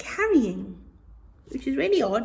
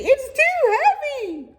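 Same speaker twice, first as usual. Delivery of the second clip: moaning camel; 4 dB louder than usual. Acted. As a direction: moan like a spoilt camel. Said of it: "said Calamity".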